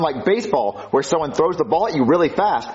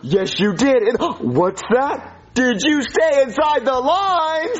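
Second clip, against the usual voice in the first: slow, high, sing-song cooing; exaggerated doting delight; gushing praise; 6 semitones higher, slower than usual. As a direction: fuss over it as to a toddler. Parent gushing over child's drawing.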